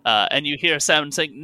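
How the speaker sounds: ordinary delivery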